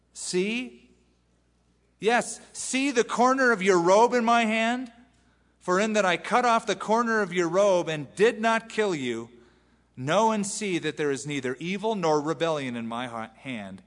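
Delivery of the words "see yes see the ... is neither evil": higher and louder urgent plea; pleading David. Acted. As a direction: hold up the robe corner and plead.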